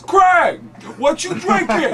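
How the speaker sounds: high, loud mimicked call; Tracy Morgan. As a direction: call out as a brash comedian. Tracy calls from the bar.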